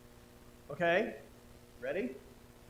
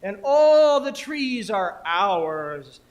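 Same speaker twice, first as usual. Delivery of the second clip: loud, slow, elevated chant; poem performance; 3 semitones higher, 11 dB louder, slower than usual. Acted. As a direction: proclaim it grandly with drawn-out vowels. Performs the poem as rain gods.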